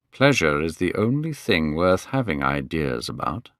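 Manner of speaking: slow, musical voice. Acted. Lord Henry answering Dorian at dinner, slow and musical. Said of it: "he answered in his slow, musical voice".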